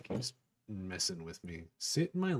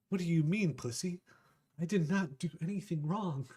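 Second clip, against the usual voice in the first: catgirl Amelia; 6 semitones higher than usual. Acted. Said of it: "she says".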